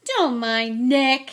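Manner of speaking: loud, slow, booming call; shout. A narrator's reading. Sir Patrick's head calling from the floor, shouting. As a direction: holler it out heartily. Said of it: "shouted Pa-, Sir Patrick's head".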